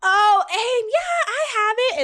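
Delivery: high-pitched pretend casual. Jeanette pretends she knows aim, high put-on voice. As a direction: go way up high, faking that you know it. Acting Jeanette's eager cover-up reply.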